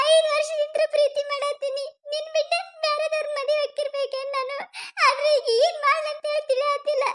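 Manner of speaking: high tearful pleading, voice breaking; crying. Acted. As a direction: pour out her despair through sobs. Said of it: "ಅಳ್ಬೇಡ". (ಅಳುತ್ತಾ ತನ್ನ ಸಂಕಟ ಹೇಳಿಕೊಳ್ಳುವ ಜಾನು)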